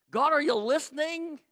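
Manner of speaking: high, insistent question; urgent, high-pitched plea; pounding on heaven's door. Acted. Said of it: "he starts pounding on heaven's door".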